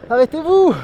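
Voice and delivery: yelling at runners to stop; loud desperate shout. Real, not acted. Runners won't stop, calling out.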